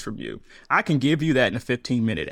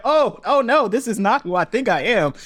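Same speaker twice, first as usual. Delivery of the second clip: higher, louder mock alarm; oh-no moment, raised and louder; dismayed realization; 9 semitones higher, 3 dB louder than usual. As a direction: catch yourself in horror. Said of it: "it was like".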